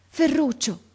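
put-on high, frightened call from an old woman; terrified, barely intelligible. A narrator's reading. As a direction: call out in fear, teeth chattering. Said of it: "chiamò con voce appena intelligibile, battendo i denti". (chiamata atterrita, appena intelligibile)

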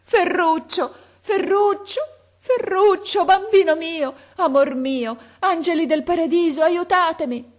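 very high, loud, anguished cries; desperate full-voice scream. A narrator's reading. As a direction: scream for help with all your voice. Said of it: "con quanta voce avea in gola, disperatamente". (grido disperato, a squarciagola)

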